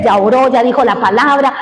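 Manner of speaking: usual speaking voice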